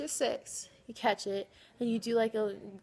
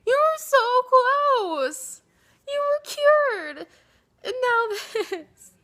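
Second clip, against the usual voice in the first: high, loud, slow wailing lament; anguished wail; 14 semitones higher, 9 dB louder, much slower than usual. Real, not acted. Friend wailing over a re-injured hip. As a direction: cry it out in mock despair.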